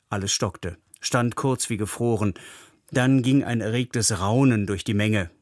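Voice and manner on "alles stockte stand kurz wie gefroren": normal talking voice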